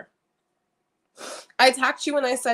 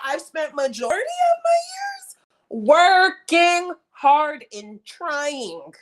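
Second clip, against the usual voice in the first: high, breaking cry of frustration; crying shout; 6 semitones higher, 4 dB louder, slower than usual. Real, not acted. Vlogger venting about years of hard work, crying shout. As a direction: let the voice crack in exasperation.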